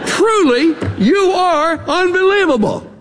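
high exasperated sarcasm; sarcastic. Retold or imitated. Job scoffs at his friends, high and sarcastic. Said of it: "having learned a little sarcasm".

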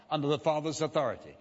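ordinary delivery

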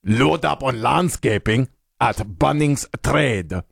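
slow mock Croatian accent, flattened vowels. Host performs sponsor line for co-host to guess, slow mock Croatian accent. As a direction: read it like a Croatian, with "Trade" as "Tred".

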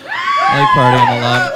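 very high squealed party line; shrill party shriek. Acted. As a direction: squeal it like a drunk party girl. Shrieked repeat of same line.

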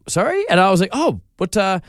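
high-pitched, taken-aback questioning; stumped. Retold or imitated. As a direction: retell being caught off guard, pitch jumping up. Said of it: "I'm there just stumped".

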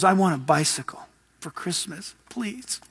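put-on a child's whispered, hopeful request; soft little boy begging for a bike; whispered plea. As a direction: breathe it like a small kid asking. Kid asking mom in story.

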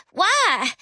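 put-on high whiny little-girl why. A narrator's reading. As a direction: whine it like a sulking kid. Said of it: "Charlie asked in a whiny voice".

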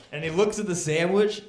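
ordinary talk, nothing marked

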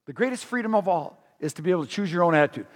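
fast, lighter paraphrase; quick plain recap. Shown as a demonstration. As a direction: rattle off the restatement. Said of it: "He says".

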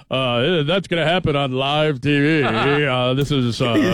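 old-school anchor impression, drawn out; Walter Cronkite. Mocking the studio host on live TV, anchor impression. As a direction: imitate a stately TV news anchor, slowing down. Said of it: "Walter Cronkite reporting".

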